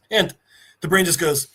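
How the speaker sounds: usual speaking voice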